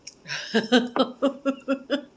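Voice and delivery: high laugh at herself. Real, not acted. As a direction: laugh at the heat. Laughing at own overspicing.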